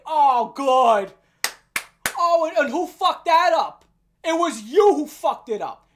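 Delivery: loud, sarcastic blast; loud outburst. Real, not acted. Ranting at the failed organizer, shouting in scorn. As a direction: explode at him with scorn. Outburst after mock quote.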